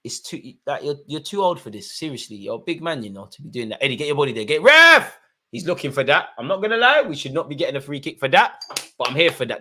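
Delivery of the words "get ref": high, loud appeal; yelling at the ref. Real, not acted. Fan yelling at the TV for a free kick, loud. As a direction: bellow it at the screen like a fan demanding a foul.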